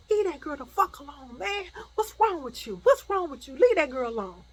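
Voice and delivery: high, loud, exasperated challenge; angry demand. Retold or imitated. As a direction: order him off, acting out the cousin. Acted cousin confronting attacker.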